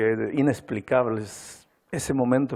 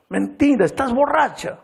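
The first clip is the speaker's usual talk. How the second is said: higher, loud scornful accusation; contemptuous accusation; 12 semitones higher, 5 dB louder than usual. Retold or imitated. Husband accusing his wife of drinking, loud scorn. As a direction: spit it out in contempt. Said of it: "la miró con desprecio".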